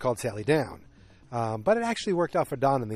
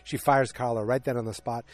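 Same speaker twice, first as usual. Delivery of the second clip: normal talking voice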